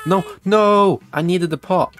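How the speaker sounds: loud, high dismayed cry; panicked shout. Real, not acted. Streamer losing the pot, loud high dismay. Customer takes the pot away.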